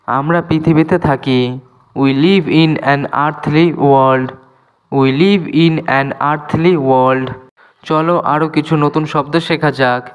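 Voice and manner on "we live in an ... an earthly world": slow, clear English for learners; careful dictation pace; slow teaching demo. Shown as a demonstration. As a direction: spell each word out slowly for students. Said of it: "teacher repeats example slowly".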